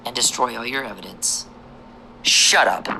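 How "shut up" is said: sharp loud shout to shut someone up; angry loud shut up; sudden shout. Real, not acted. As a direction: bark it out, fed up. Snaps at phone intruder.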